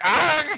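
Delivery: high, loud startled cry; sudden pained shriek; mock yelp. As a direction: yelp as if shot point blank. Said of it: "He's like".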